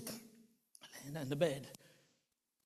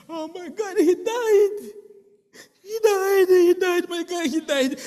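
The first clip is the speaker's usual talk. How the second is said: high, loud sobbing wail; a sister crying out that her brother died; crying in grief; 10 semitones higher, 8 dB louder than usual. Retold or imitated. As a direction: wail as if he's gone.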